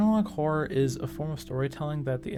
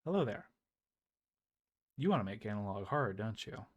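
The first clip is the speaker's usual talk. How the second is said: lower, softer, knowing creepy voice; creepy teasing host; 3 semitones lower, 8 dB quieter, faster than usual. Acted. Horror host greeting the would-be creator, low and soft, creepy. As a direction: lean in and tease the viewer like a lurking presence.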